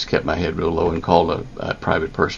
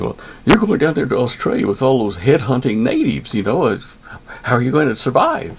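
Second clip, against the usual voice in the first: mother voiced higher and a bit louder, fretting; worried mom; 8 semitones higher, 4 dB louder than usual. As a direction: play the upset mother pleading with her son. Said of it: "Mom got all upset and said".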